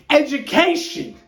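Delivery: high, loud pulpit cry; hammed-up preacher delivering the word; mock preacher. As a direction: play the fired-up pastor, loud and high. Mock-preaching as Pastor Sever.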